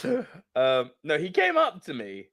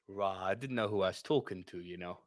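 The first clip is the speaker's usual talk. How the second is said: sheepish backing down; 10 semitones lower, 12 dB quieter than usual. Retold or imitated. Mugger retreating, retold.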